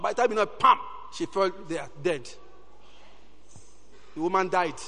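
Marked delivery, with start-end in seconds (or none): none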